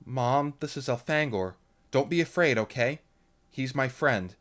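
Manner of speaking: put-on a teen girl gently warning her mom; a lightly voiced anxious girl; Lauren. A narrator's reading. Lauren pleads with her mother.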